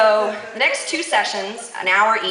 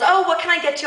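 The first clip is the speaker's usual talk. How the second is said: higher, lightly voiced helpful salesman; shoe salesman; 8 semitones higher, faster than usual. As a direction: lightly imitate a salesman greeting a customer. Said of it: "the shoe salesman says".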